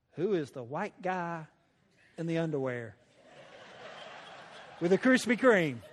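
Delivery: a hint of a bewildered sister, laughing; amused imitation. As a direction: lightly voice her puzzled question through laughter. Sister's startled question, laughing.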